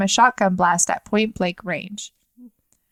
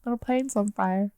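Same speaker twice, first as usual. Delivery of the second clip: hushed; 8 dB quieter than usual. Pretend. Soft mock toddler line.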